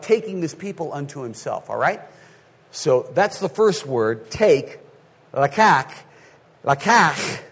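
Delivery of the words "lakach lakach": lakach attempted twice, ending in a hard k; trying lakach. Shown as a demonstration. Pastor struggling to pronounce lakach in front of the church. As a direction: lakach sounded out with a falling end. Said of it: "I can't say it".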